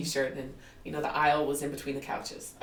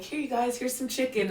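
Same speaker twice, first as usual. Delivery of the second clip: eager offering; 8 semitones higher than usual. Retold or imitated. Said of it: "I'm like".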